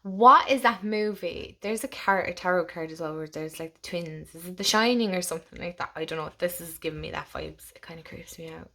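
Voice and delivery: usual speaking voice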